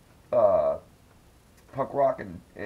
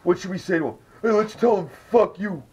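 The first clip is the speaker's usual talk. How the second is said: higher, louder sneering impression of the hecklers; mocking rant; 8 semitones higher, 5 dB louder than usual. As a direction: scoff at their lame plan. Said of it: "He's like".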